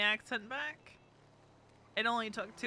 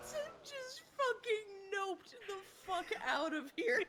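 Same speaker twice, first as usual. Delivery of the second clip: laughing; 11 semitones higher than usual. Real, not acted. Laughing at Tim leaving.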